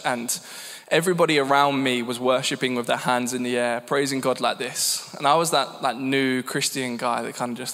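ordinary talk, nothing marked

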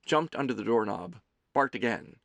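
ordinary delivery, nothing marked

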